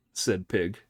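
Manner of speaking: ordinary delivery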